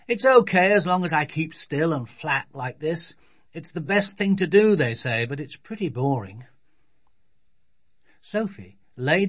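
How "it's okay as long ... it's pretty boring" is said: put-on dad's voice starting raised, then settling; grumpy father. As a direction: grudgingly make the best of it. Voices father lying on floor.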